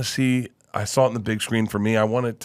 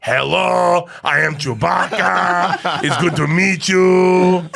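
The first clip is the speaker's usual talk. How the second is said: slow, loud, higher mock Chewbacca voice; bad Chewbacca impression; 9 semitones higher, 4 dB louder, much slower than usual. Asked to do Chewbacca, the co-star booms out a slow loud try. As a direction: drag it out like a clueless Wookiee attempt. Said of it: "Can you do an impression of Chewbacca?".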